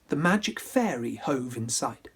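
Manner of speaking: plain reading voice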